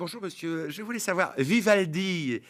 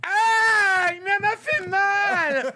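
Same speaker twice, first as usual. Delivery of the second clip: put-on whiny child voice; 12 semitones higher, 3 dB louder than usual. Caller plays a whining child.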